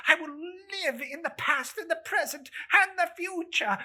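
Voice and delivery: desperate cry of a man seeing his own grave; anguished pleading. Acted. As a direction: weep and beg for your life. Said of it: "In his agony".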